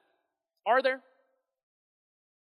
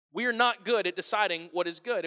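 normal talking voice

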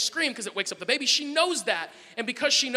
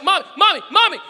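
put-on loud, high child calling Mommy; imitating small daughter; 9 semitones higher, 9 dB louder than usual. Three-year-old calls after departing mother, insistent child. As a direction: call insistently for mom like a little kid. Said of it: "she's like".

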